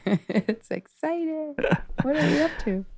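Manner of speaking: high mock-excited question about plans. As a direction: tease the guest in a high excited voice. Playful teasing question to guest.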